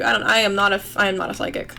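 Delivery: ordinary delivery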